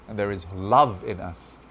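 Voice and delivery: usual speaking voice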